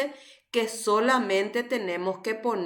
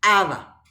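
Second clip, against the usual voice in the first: Aba with a loud, stressed first A; first-vowel stress; 6 dB louder than usual. Shown as a demonstration. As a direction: punch the first A of Aba, let the end fall. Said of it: "lleva acento en la primera vocal".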